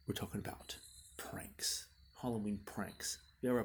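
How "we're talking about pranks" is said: hushed, breathy secret; spooky whisper. Acted. Teasing intro to prank topic.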